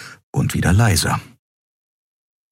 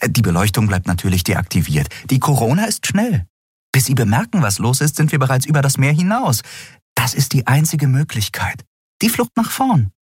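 animated, higher-pitched explaining; keen, lightly voiced character; scheming Endriel; 9 semitones higher than usual. A narrator's reading. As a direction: press the plan with rising pitch.

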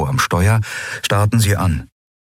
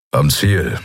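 lightly voiced grumble; 4 semitones lower than usual. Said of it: "brummte Kero".

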